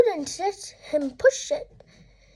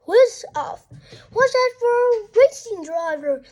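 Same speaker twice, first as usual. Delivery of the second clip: higher, louder gleeful squeal; 5 semitones higher, 4 dB louder than usual. Acted. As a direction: squeal like a racing driver. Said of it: "squealed Henry".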